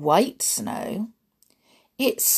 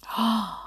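sharp gasp; 5 semitones higher, 3 dB quieter than usual. Acted. Sees the snow.

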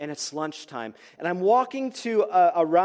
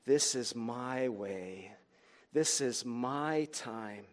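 deep, slow, much softer divine voice; voice of God; 8 semitones lower, 10 dB quieter, much slower than usual. Retold or imitated. God telling the teen this is his moment, deep and slow. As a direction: speak it slow and low like God whispering a calling. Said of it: "God was saying".

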